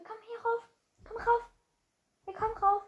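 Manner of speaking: high soft coaxing pet voice; talking to a pet. Real, not acted. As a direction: coax the pet up gently and high. Calling a pet up to him.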